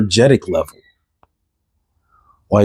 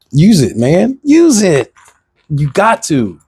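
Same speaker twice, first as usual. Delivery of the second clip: fired-up shout; 6 semitones higher, slower than usual. Real, not acted. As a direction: push them hard to use it, voice climbing. Urging viewers to use their energy.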